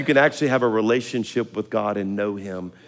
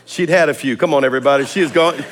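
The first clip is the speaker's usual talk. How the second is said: ordinary talk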